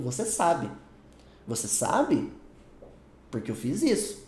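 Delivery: "Você sabe." said twice with falls and long pauses, then "por que eu fiz isso?"; broken-up reading. Shown as a demonstration. Teacher acting out the wrong meaning, with slow pauses between the chunks. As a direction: chop the line apart at "Você sabe". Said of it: "parece que tá falando".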